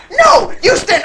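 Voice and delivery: very high, loud command; panicked kobold. Acted. Kobold grabbing a player by the shirt, panicked yell. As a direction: order them in a high, panicked shout.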